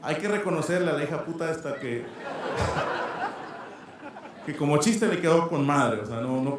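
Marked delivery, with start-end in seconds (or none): 2.1-4.4 breathy laugh. Real, not acted. Laughs at his ex's joke.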